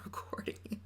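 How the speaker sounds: whispered aside, no voice. Real, not acted. Whispering to viewers about the phone voiceover. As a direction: breathe it out unvoiced.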